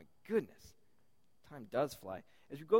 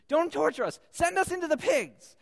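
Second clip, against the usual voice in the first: loud, high-pitched begging cry; Legion's frantic plea; 10 semitones higher, 9 dB louder than usual. Acted. Demons begging Jesus for the pigs, frantic. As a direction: beg desperately, high and loud. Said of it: "and Legion says".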